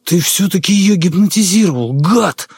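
high, rasping accusation; hoarse furious rasp. A narrator's reading. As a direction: spit it out in a rasping rage. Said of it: "бледнее прохрипел Дронов". (яростный хрип)

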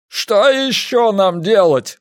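high, slow desperate shout; drawn-out helpless yell. A narrator's reading. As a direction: cry out at a loss. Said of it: "прокричал Грунг".

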